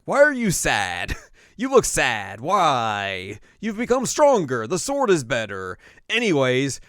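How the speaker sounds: loud, high, swooping questions; mock baffled mother. Mother talking to her sad son, mock bafflement. Mother puzzled by son's sadness.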